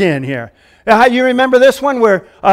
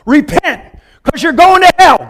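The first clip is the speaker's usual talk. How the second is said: mock preacher warning; 5 semitones higher, 4 dB louder than usual. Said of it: "he goes".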